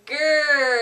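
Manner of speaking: short loud playful growl; quick growl. Pretend. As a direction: snap out a little bear growl.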